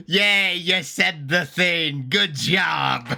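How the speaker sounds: slow, high drawn-out congratulations; mock cheer. Real, not acted. Viewer cheering narrator's title drop, slow mock cheer. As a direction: cheer the title drop, stretching every word.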